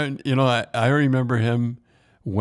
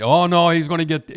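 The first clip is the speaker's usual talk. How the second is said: alarmed quote; 7 semitones higher, 7 dB louder than usual. Retold or imitated. Ron predicting ten games, quoted.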